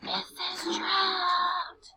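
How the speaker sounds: whisper-shout it slowly to the class. Shown as a demonstration. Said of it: "So when we whisper shout, we're going".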